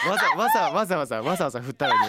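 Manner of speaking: laughing mock complaint; giggling protest. Real, not acted. Teasing while laughing.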